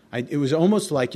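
usual speaking voice

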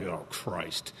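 low, quiet choked oh Christ; a small broken groan of grief; hushed, tearful oh Christ; mock sob. Bawling at the meeting.

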